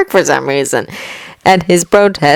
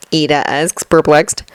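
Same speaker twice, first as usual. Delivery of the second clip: ordinary delivery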